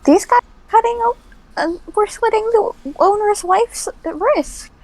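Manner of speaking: high-pitched, hurried character voice; alarmed game character. Acted. Player tells an ally about the attackers, high and alarmed. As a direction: report the attack in a high, urgent voice.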